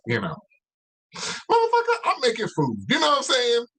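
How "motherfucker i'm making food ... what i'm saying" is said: yelling. Real, not acted. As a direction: shout it out, fired up. Suddenly yells about cooking.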